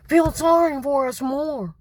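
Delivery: mocking whine. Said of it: "mocking them".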